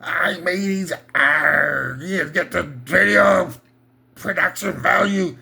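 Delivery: put-on slow, stretched-out hammy pirate accent; second try at a mock pirate accent. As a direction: growl an "arr" like a stage pirate, dragging the words. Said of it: "What happened to my private accent?".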